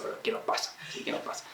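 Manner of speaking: breathy whispered question, barely audible. Acted. A spouse whispering to dodge the talk, hushed. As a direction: drop to a whisper as if avoiding the issue.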